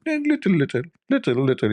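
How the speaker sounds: squeaky high, pinched little little; tiny little bit. Retold or imitated. Guest telling the host he wants only a little spice, squeaky high. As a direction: squeeze the words small like a fingertip. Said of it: "I made my finger like".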